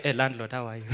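small, low, soft voice; meek greeting. Acted. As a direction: drop to a meek, quiet hello. Meek greeting when landlord sees you.

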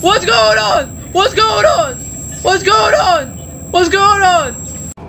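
high, frantic repeated cries; panicked. Real, not acted. Gamer panicking as something attacks, high frantic yelps. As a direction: panic over and over. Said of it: "I keep on screaming like that".

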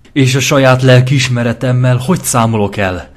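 bare the teeth and snarl the question. A narrator's reading. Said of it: "kérdezte vicsorogva".

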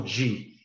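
"di" pronounced "dji", as in southeastern Brazil; softened "dji". Shown as a demonstration. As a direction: let the D of "di" turn into a J-like "dji". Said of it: "Eles não usam o".

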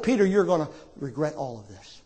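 low, hushed reply; grave warning. Acted. The Lord warning a boastful Peter, grave and quiet. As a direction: drop down low and soft, trailing away.

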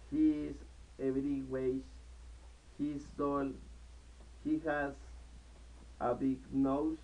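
ordinary talk

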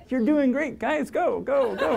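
very high squeaky little ant voice; tiny ant voice. Retold or imitated. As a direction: cheer them on in a tiny ant voice. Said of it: "I can just hear their little ant voices".

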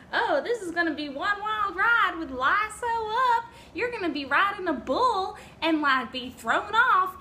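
high, loud, over-the-top sales pitch; mock hype. Vlogger mocking the beer's overblown marketing, mock hype. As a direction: build it up like a wild ride, hammed up. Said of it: "They really build it up, like".

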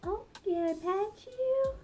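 high, slow coaxing voice; high and gentle, drawn-out words; gentle pet talk. Real, not acted. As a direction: coo questions to a pet bird. Coaxes her pet birds.

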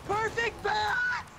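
high, drawn-out screech of joy; pterodactyl shriek. Real, not acted. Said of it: "I sounded like a pterodactyl when I screamed".

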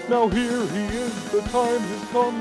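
usual speaking voice

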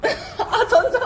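real loud laughter breaking into the words; laughing loudly. Real, not acted. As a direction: burst out laughing, high and loud. Laughing at host's joke about kimchi.

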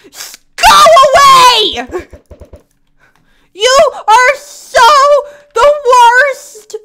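very high, loud, slow wailing shout; mock sobbing yell. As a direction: wail at the tormentor, half crying. Egged again, fake sob yell.

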